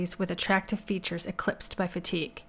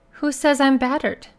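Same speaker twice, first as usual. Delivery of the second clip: higher, louder, affronted question; stung and defensive; defensive retort; 6 semitones higher, 6 dB louder than usual. Acted. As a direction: throw the accusation back, pitch raised. Woman disputes being called battered.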